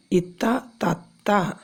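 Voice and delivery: slow, careful തത്ത; patient teacher drawing out തത്ത; slow teaching. Shown as a demonstration. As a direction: say തത്ത slowly, syllable by syllable. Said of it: "സംസാരിക്കുന്ന പക്ഷിയാണ് തത്ത".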